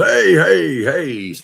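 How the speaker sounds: high, loud "hey, hey, hey" to split them apart; retold loud call to stop a fight; calling to break it up. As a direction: step in and shout them down, half-hammed. Rescue guy breaking up fight.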